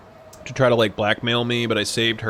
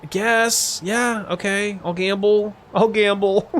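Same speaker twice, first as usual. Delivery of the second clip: reluctant giving in; 8 semitones higher than usual. Retold or imitated. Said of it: "I'm just like".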